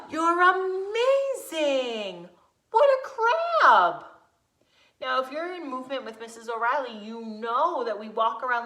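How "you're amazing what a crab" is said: much higher and louder, slow and gushing; delighted praise. Real, not acted. Teacher cheering her little crabs, excited and slow. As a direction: burst out with big slow delight.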